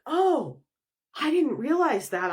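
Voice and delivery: mock dawning realization, pitched high; surprised realization. Energy answering its host, surprised realization.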